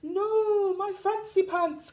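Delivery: high distressed hippo; cried out. A narrator's reading. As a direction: wail it out in distress. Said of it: "cried the hippo".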